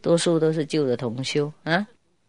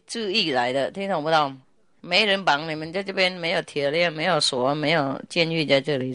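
ordinary talk

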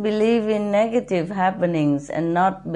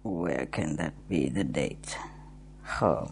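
muttering; 10 dB quieter than usual. Real, not acted. Muttering while searching diary.

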